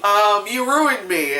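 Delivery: slow, drawn-out blame; slow accusing. Retold or imitated. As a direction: lay on the guilt slowly. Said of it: "She's saying".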